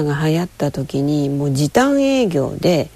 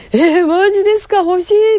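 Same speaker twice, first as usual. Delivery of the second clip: high, loud squeal of delight; squealing when offered the bear as a gift; thrilled; 14 semitones higher, 4 dB louder than usual. Retold or imitated. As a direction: pitch way up and gush. Retold joy at being offered it.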